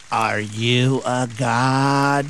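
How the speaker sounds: slow, low quoted line; movie line quote. Retold or imitated. Host quoting Ghostbusters, slow low movie voice. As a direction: draw it out like the film. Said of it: "that Ghostbusters where Gozer".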